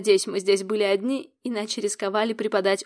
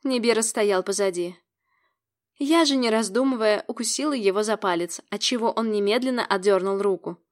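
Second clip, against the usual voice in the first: ordinary delivery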